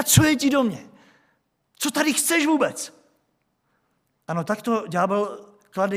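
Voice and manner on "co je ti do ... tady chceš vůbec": defiant demoniac. Acted. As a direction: throw the question back at Jesus, high and resentful. Acts the man shouting at Jesus.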